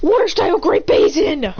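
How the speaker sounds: high, loud anime move call; attack call. Acted. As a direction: call out the technique with force. Character calls out an attack.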